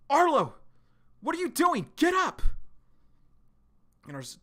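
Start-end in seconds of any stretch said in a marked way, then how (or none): 0.1-2.4 high, loud call to a fallen friend; panicked call. Retold or imitated. Girl shouting at fallen Arlo, high and loud. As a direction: shout his name to wake him up.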